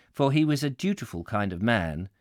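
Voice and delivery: plain reading voice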